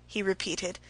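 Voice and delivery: ordinary talk, nothing marked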